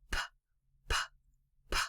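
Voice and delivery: "p" as a bare puff of air, no vowel; just a puff of air for "P" and "p"; whispered p. Shown as a demonstration. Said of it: "a puff of air comes out".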